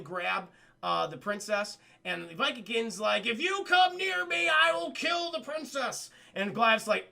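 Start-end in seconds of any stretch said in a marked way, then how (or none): 3.3-6.1 high, strained hostage-taker's threat; hammed-up high-pitched ultimatum; mock threat. Acting the Viking King's threat.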